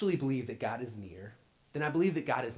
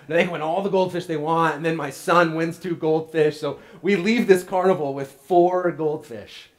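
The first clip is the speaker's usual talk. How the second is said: loud, mock-incredulous build-up; excited; 5 semitones higher, 13 dB louder than usual. Real, not acted. Pastor tells congregation about the goldfish, loud and incredulous. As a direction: pile on the disbelief.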